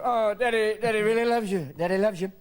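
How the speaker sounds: high, strained fake cheer; lightly voiced weary dad. Tired dad placating his kids at dawn, forced cheer. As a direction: sweeten it over the exhaustion. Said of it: "you get up and go".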